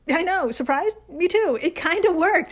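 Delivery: mock surprise. Real, not acted.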